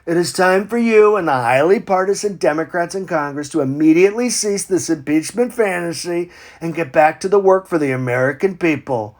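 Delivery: ordinary talk, nothing marked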